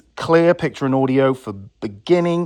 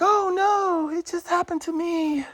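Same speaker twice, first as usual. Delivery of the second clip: high-pitched mocking lament; mock-helpless customer; 3 dB quieter than usual. Vlogger mimicking people who got burned, mock-helpless. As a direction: put on a high, softer victim voice.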